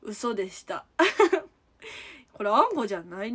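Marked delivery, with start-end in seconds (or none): 0.9-1.5 high, loud short laugh; bursting laugh. Real, not acted. Streamer taking back the anko claim, bursting laugh.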